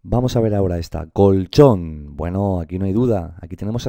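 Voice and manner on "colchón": colchón loud, landing on CHÓN; stress on final syllable. Shown as a demonstration. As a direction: punch and hold CHÓN in colchón. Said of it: "la sílaba tónica es esta".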